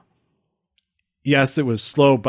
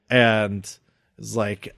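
normal talking voice

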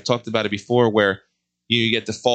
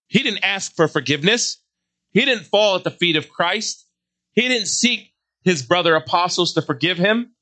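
higher, insistent preaching list; rising preaching; 6 semitones higher than usual. Acted. Preacher condemns Judas's despair, rising insistent. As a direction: preach it up, line after line.

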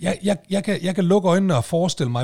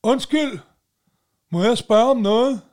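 higher-pitched mimicked stranger calling out for attention; imitated interrupting stranger; 6 semitones higher, 5 dB louder than usual. Stranger interrupting a man stuck in his car, imitated calling out. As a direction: call out a retold polite interruption, pitched up. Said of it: "Så hører jeg ham der sige".

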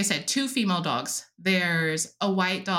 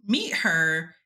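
normal talking voice

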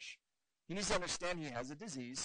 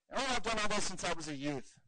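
higher and louder, self-satisfied boast; smug young ruler; 5 semitones higher, 6 dB louder than usual. Retold or imitated. Rich young ruler answering Jesus, smug and proud. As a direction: puff up like you've kept every rule. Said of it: "the most hilarious answer".

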